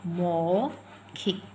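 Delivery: मौखिक very slow, long औ; drawn-out maukhik. Shown as a demonstration. Teacher to class, slowly drawing out मौखिक. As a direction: stretch out the औ of मौखिक. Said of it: "हम लिखेंगे मौखिक".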